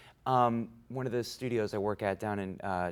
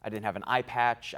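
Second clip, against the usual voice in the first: normal talking voice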